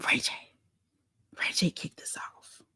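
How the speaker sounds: a conspiratorial whisper. Real, not acted. Host confiding to viewers, whispered. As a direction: whisper it like a secret. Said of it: "whispered reveal".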